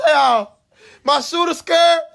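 high, crying panic; panicked cry. Retold or imitated. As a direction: whimper it like you're about to be caught. Panicking as the clown chases.

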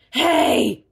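loud sharp yell; shouted. Acted. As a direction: yell to stop him. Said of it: "shouted Pig".

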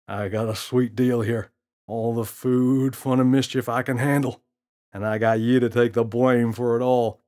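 put-on a cocky gorilla teasing the kid; smirking gorilla. Gorilla voiced in story.

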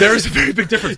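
fast, laughing agreement; high, quick laughing echo. Real, not acted. Echoing with a laugh.

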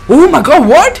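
high, loud disbelieving cry; rising shocked yell; shocked shout. Real, not acted. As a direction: yell it out, caught completely off guard. A sudden in-game death.

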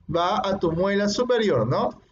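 ordinary talk, nothing marked

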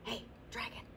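soft breathy whisper. Acted. Princess checking if dragon sleeps, whisper. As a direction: barely breathe it to test his sleep. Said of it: "Elizabeth whispered very softly".

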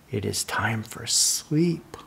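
soft unvoiced whisper. Whispering bedtime to the child. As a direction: hush it like bedtime. Bedtime page, hushed reading.

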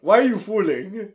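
high, loud mock demand; mock screaming. Retelling the characters' screaming match, mock shouting. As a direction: keep up the hammed-up yelling. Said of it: "they're fucking screaming at each other".

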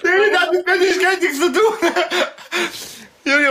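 high, laughing denial. Real, not acted. Laughing while denying.

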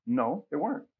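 normal talking voice

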